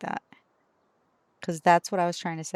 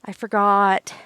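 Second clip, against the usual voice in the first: loud retold exclamation; 9 dB louder, slower than usual. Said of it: "and I was like".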